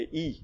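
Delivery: ई said as a soft, drawn-out long ee; long ee sound. Shown as a demonstration. Teacher shows students the sound ई. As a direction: model the vowel ई for the class. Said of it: "इसकी आवाज़ जो है वो ई होती है".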